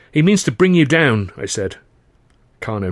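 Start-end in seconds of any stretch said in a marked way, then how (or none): 0.1-1.3 higher, louder urgent warning. A narrator's reading. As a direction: press the warning home. Narrator warns Carno urgently.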